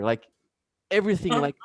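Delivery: normal talking voice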